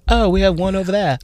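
put-on light New Zealand accent, softly; a quick hint of New Zealand accent. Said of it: "That was a good New Zealand accent".

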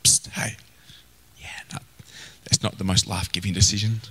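a soft unvoiced whisper, close and intimate; the Holy Spirit gently whispering advice; gentle whisper. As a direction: whisper it like a kindly nudge in the ear. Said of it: "the Holy Spirit whispers".

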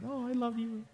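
high, soft mock-lovestruck coo; lovey-dovey new couple. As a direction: play a smitten partner in a high, soft voice. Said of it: "Some of the new couples are just like".

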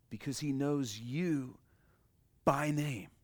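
usual speaking voice

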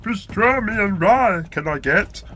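loud, high croaky little alien sage; Yoda. Shown as a demonstration. Coach on a speed test, croaky sage impression. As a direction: impersonate a wise puppet creature, hammed-up.

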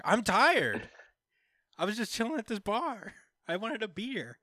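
high-pitched mock whine; tired complaint. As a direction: complain like a put-upon barfly. Irish dude complains he's tired.

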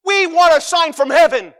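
high, loud demanding yell; mock shout. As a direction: shout it like impatient hecklers. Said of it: "They said".

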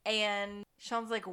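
usual speaking voice

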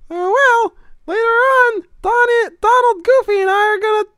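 high squeaky cartoon mouse; Mickey Mouse voice. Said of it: "my Mickey impression".

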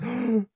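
sharp high gasp; mock gasp. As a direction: gasp like a crewman in shock. Survivor gasping on wrecked ship.

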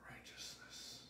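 hushed whisper. Real, not acted. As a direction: drop to a breathy whisper on the last word. Quiet climax of sentence.

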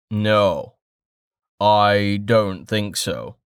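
slow, deep-voiced man refusing; measured, resonant baritone, drawn out slowly; rich baritone. A narrator's reading. Merchant refusing the bandit, rich slow baritone. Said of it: "replied in a rich baritone".

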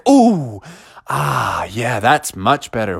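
long "ah", then low and soothed; relieved sigh. Acted. Mother's face cooled by water.

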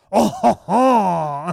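very loud, high, drawn-out French chortle; hammed-up French accent. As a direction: stretch out a cartoon Frenchman's laugh. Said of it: "This is exactly how you say it".

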